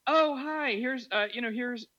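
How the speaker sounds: high, chirpy mom; bubbly neighbor showing off her dog; a high voiced friendly mom. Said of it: "and she's like".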